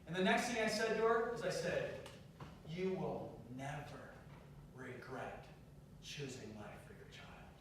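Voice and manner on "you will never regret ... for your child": slow, low and very soft; hushed, measured comfort; tender reassurance. Retold or imitated. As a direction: reassure her gently, almost under your breath. Retells tender words to crying teen.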